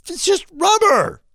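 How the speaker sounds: high-pitched incredulous protest; exasperated outburst. Retold or imitated. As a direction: throw it out in high, exasperated disbelief. Retells exasperation with case sellers.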